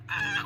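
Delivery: quick high pretend scream; mock scream. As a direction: squeak out a tiny mock shriek. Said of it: "she's screaming".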